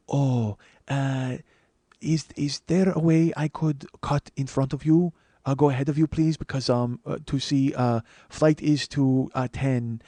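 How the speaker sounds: put-on slow, halting Japanese-accented plea; imitated Japanese businessman, hesitant and courteous; soft Japanese accent. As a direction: politely grope for the English words in a Japanese accent. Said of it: "gonna do that Japanese voice".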